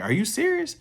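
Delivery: high-pitched imitation of a stunned friend; retold disbelief. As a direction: act out his surprise, voice jumping up. Friend reacts to the calorie count.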